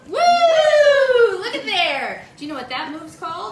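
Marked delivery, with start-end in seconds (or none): 0.1-2.2 loud delighted woo with a laugh; excited whoop. Real, not acted. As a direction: whoop at the flame. Flambe flame bursts up.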